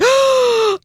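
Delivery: high, drawn-in mock gasp; big acted gasp of shock. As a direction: imitate a scandalized gasp. Congregation turns in shock, mimicked.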